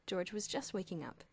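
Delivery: usual speaking voice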